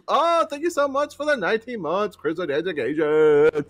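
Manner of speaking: high, loud hammed-up donor thank-you; imitating a streamer shoutout. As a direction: ham up the streamer greeting, way up high. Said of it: "That's how he said it".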